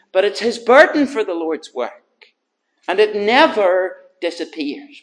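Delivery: earnest sermon voice building up; preaching. Acted. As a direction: press the point home to the congregation. Preaching with force.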